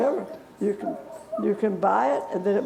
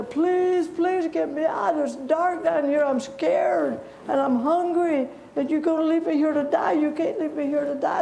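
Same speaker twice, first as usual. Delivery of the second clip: put-on pleading boy crying; 6 semitones higher than usual. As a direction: beg them to get you out of the pit. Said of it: "I think I would have been crying".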